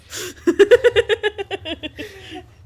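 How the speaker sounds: loud, high burst of real laughter. Real, not acted. Laughing at her own popcorn joke with friend, loud laugh.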